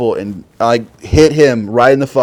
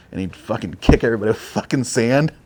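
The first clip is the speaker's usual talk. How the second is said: normal talking voice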